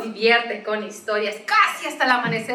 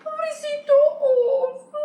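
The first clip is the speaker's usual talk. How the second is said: pitying. A narrator's reading. Pitying the crying bear.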